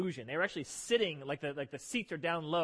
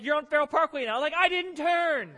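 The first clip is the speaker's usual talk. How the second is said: husband's raised protest at wife; exasperated protest; 9 semitones higher, 7 dB louder than usual. Retold or imitated. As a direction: drag out the denial. Acted quarrel with wife.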